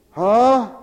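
ordinary talk, nothing marked

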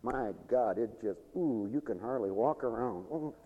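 normal talking voice

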